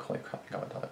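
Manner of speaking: very soft, breath-only exclamation; whispered relief. Retold or imitated. Thinking of the near break-in, a relieved whisper. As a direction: whisper it under your breath.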